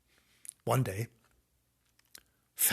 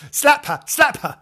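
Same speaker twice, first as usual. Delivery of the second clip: high, loud comic chant; mock baying crowd; 11 dB louder than usual. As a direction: egg them on in a pitched-up yell. Mock urging children to slap Fanny.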